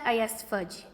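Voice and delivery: plain reading voice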